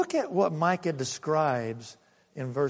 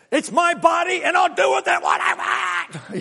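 imitated shout, much higher and louder; retold screaming; 12 semitones higher, 5 dB louder than usual. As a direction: yell it like an angry protester at a pamphlet handout. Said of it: "she started screaming at him".

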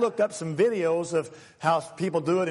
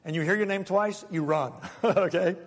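normal talking voice

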